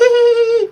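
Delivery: very high, loud held squeal; kiddish squeal. Shown as a demonstration. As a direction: squeal "hee" like a little kid, high and loud. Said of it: "kiddish voice?".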